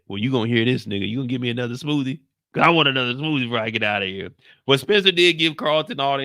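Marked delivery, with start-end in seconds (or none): 0.1-4.3 rushed, low quip; fast mock demand. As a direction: rattle it off. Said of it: "Spencer said".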